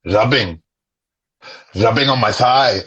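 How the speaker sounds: very low, measured catchphrase with pauses; demonstrated recitation. Shown as a demonstration. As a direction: intone it deep and deliberate, like verse. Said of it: "and it goes like this".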